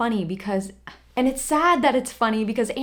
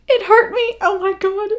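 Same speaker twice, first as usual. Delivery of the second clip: pitched much higher and louder, wounded; anguished outcry; 11 semitones higher, 7 dB louder than usual. Real, not acted. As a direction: cry it out like the words stung. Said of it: "I literally gasped because it hurt me".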